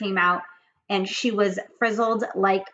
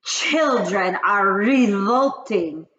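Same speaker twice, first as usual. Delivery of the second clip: loud, slow witch cry; witch scream; 3 semitones higher, 8 dB louder, much slower than usual. Acted. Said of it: "screamed the Grand High Witch".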